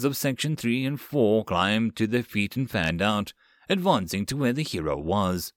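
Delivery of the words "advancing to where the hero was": usual speaking voice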